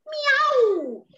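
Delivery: high playful "Miau, miau"; cat meows. Acted. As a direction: meow politely at the castle gate.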